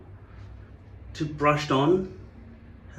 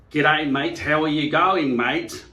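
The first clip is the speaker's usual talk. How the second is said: loud mock Australian greeting; attempted Australian accent; 5 dB louder, slower than usual. English visitor trying out an Aussie greeting, mock Australian accent. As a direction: put on a broad Australian accent. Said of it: "tried to do an Australian accent".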